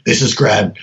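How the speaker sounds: low, gruff telephone greeting; gruff Grad voice. Retold or imitated. Friend calling late at night, low and curt. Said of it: "it would just start out going".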